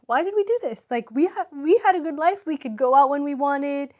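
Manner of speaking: half-reliving the desperate question to her husband; anguished pleading. Retold or imitated. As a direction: let the regret burst out as you repeat it. Repeats the complaint with feeling.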